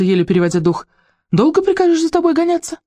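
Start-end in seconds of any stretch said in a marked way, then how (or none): 1.3-2.8 scolding saleswoman. Acted.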